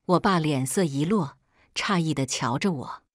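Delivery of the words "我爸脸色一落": ordinary delivery, nothing marked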